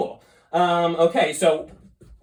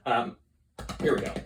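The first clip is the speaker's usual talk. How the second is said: ordinary delivery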